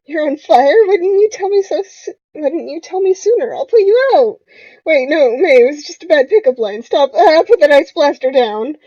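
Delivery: high, loud, frantic protest; mock panic. Flirting gone wrong before an ice blaster, acted panic. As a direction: yell in hammed-up alarm.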